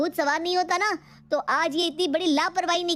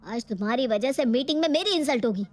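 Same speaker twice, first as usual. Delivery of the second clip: ordinary delivery, nothing marked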